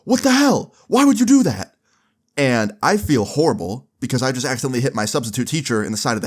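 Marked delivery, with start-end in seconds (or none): 0.0-1.8 high, loud shocked outburst; shocked yell. Retold or imitated. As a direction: yell in pain and disbelief. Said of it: "And he's like".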